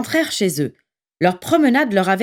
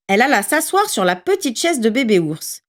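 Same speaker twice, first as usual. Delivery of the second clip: ordinary delivery, nothing marked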